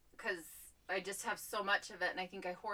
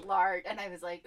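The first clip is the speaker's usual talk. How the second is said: ordinary delivery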